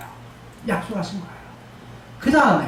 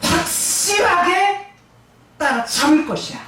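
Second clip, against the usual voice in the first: 확실하게 stretched long, pitched up; emphatic certainty; 5 semitones higher than usual. Shown as a demonstration. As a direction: drag out 확실하게 to insist. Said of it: "이런 말이에요".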